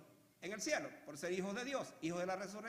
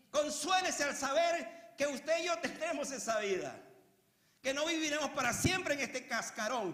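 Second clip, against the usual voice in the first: raised voice, ringing out to the congregation; forceful preaching; 5 semitones higher, 6 dB louder than usual. Real, not acted. Preacher consoling brethren with the new life, forceful preaching. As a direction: preach it hard, driving each phrase home.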